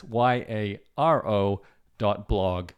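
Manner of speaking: slow, letter-by-letter spelling of "Y A R O dot blog"; spelled out slowly. Real, not acted. Reminding viewers where to find the newsletter, spelled slowly. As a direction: repeat the address, each letter of "Y A R O" set apart. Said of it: "So again".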